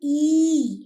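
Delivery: long, loud ई sound; drawn-out ई. Shown as a demonstration. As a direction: sing out the vowel ई clearly. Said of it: "teacher demonstrating vowel sound".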